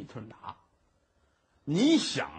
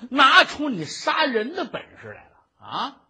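angry taunt; 7 semitones higher, 7 dB louder than usual. Acted. Judge taunts the prisoner.

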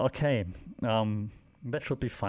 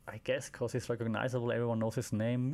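ordinary talk, nothing marked

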